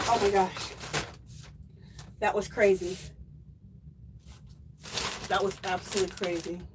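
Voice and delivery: teary. Real, not acted. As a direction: speak through watery eyes after coughing. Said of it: "that I'm crying".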